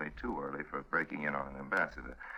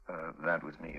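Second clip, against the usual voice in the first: normal talking voice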